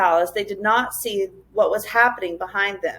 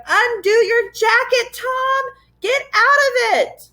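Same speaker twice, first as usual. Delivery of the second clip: much higher, louder slow desperate cry; crying out in fear; 13 semitones higher, 4 dB louder, slower than usual. A narrator's reading. Lindy crying to Tom, sick with fear. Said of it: "Lindy managed to cry".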